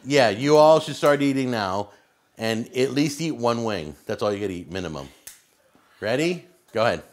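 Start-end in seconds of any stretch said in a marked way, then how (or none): none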